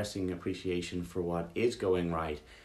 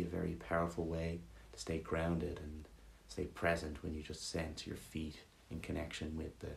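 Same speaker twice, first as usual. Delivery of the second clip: calm, even meditation voice, softer than the welcome; guided meditation; 6 dB quieter than usual. Real, not acted. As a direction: guide them gently through a body scan, leaving pauses. Leads a gratitude meditation.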